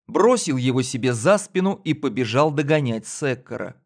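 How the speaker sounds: plain reading voice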